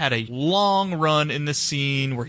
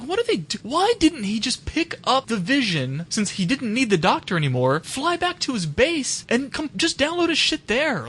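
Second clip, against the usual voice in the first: higher exasperated rant; baffled ranting questions; 7 semitones higher than usual. Retold or imitated. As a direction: pick apart the plot in disbelief. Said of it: "I was like".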